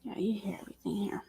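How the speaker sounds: fast whisper. Real, not acted. Whispering to stream viewers about thin walls. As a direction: hush it so the neighbours won't hear.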